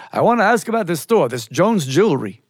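New York Yiddish uncle on the phone; New York Yiddish accent. Acted. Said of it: "that New York Yiddish accent".

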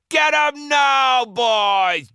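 high, loud, drawn-out battle cry; pirate captain's charging shout; cried out. A narrator's reading. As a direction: holler the attack to your men. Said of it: "Joe cried out".

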